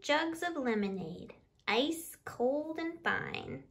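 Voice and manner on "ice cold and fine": plain reading voice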